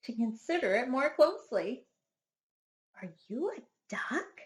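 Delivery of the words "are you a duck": soft whispered question. A narrator's reading. Duck asks the duckling in a soft whisper. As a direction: breathe the question to a tiny creature.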